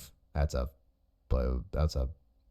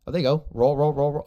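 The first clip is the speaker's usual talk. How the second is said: calling out; 8 semitones higher, 3 dB louder than usual. Real, not acted. Coach shouting to grappler mid-roll.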